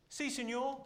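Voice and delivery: high, loud heckler call; mock shouted reply from the crowd; imitated audience shout. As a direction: shout it out like a punter. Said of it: "Someone shouted".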